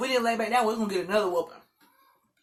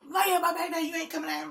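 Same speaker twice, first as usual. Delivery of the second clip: high-pitched scolding command; no-nonsense mama; 8 semitones higher than usual. Retold or imitated. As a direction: imitate a mother who isn't playing. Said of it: "my mom wasn't playing it".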